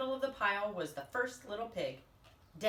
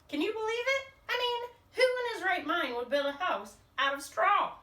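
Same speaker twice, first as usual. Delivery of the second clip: incredulous; 9 semitones higher, 5 dB louder than usual. A narrator's reading. Wolf mocks pig's straw house.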